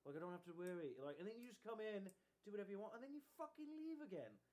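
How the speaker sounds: put-on high-pitched cat with an English accent. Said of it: "gave him an English accent".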